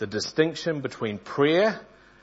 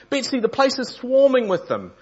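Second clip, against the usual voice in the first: high, louder alarmed cry; 14 semitones higher, 7 dB louder than usual. Acted. Corrie, finding the bunks full of fleas, calls high to Betsy in alarm. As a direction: shrink back from the swarm in alarm. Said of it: "I cried".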